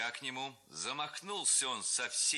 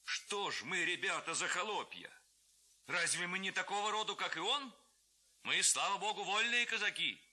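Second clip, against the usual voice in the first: proud defiant Cossack; 8 semitones higher than usual. A narrator's reading. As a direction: stir them to defiance. Lads rally as free Cossacks. (хлопцы гордо кричат о вольных казаках)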